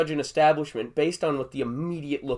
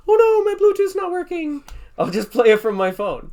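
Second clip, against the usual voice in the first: high, louder mock panic over a dead speaker; mock dismay, much higher and louder; mock whiny gym guy; 7 dB louder than usual. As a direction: ham up a helpless lifter. Mocking a music-dependent trainee.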